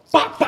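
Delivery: sharp, high mouth-made gunshot bangs; imitated gunshots. Guest re-enacts the shootout for the host, mimicked gunfire. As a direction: imitate rapid close-range gunfire. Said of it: "foi assim, ó".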